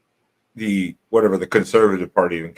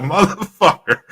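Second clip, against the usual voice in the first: mock indignant squeal; 10 semitones higher, 4 dB louder, slower than usual. Repeating the outraged exclamation.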